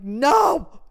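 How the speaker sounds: loud, emphatic "No"; sudden loud rebuff; retold firm refusal. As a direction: shout him off in mock anger. Retold refusal, arm held up.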